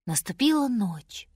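ordinary delivery, nothing marked

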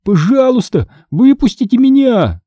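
high, panicked shouts for help; shrill frightened cries. Acted. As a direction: pound the glass and beg to be let out. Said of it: "Мой дрожащий пронзительный голос". (дрожащий пронзительный крик о помощи)

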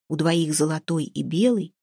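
usual speaking voice

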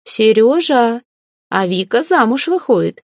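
high, ringing announcement; calling out. A narrator's reading. Mother announces daughter's wedding to father.

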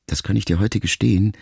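usual speaking voice

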